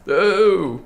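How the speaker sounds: sudden high yelp; startled shout. Real, not acted. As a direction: jump and yell out. Sudden scare in game.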